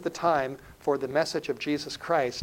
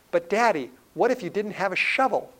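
put-on higher little-boy voice, questioning; young son; 5 semitones higher, 3 dB louder than usual. Son questions dad about a shovel, imitated child voice. Son's worried question in story.

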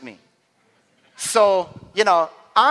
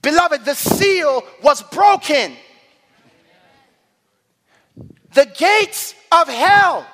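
forceful preaching; 6 semitones higher, 6 dB louder than usual. Real, not acted. Preacher reading the resurrection to the congregation, forceful preaching. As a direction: proclaim it, stretching the big moments.

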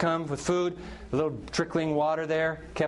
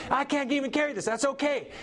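mock overwhelmed; 9 semitones higher than usual. Giver overwhelmed by abundance.

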